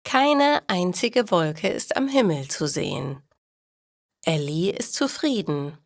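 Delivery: normal talking voice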